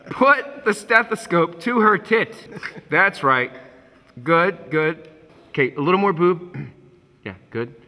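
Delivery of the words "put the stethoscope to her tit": slow, spelled-out repeat. Shown as a demonstration. Director slowly repeating his order to a confused actor. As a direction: say "Put the stethoscope to her tit" slowly, leaning on "Put".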